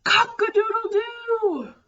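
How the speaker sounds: high, stretched rooster crow; playful cock-a-doodle-doo for kids. Shown as a demonstration. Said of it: "I say".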